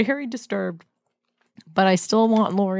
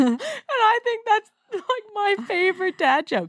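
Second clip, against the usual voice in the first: laughing; 13 semitones higher than usual. Real, not acted. Host laughing at her dad's joke, giggling. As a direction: laugh it out, can't hold it in.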